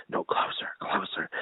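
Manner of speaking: barely audible whisper, imitating a child; secretive whisper. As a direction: whisper it as if drawing dad's ear closer.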